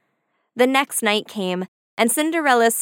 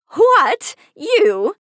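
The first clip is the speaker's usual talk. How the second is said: scornful cry, much higher and louder; wicked stepmother; 9 semitones higher, 6 dB louder than usual. A narrator's reading. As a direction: shriek it in mocking disbelief. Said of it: "cried the stepmother".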